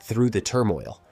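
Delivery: plain reading voice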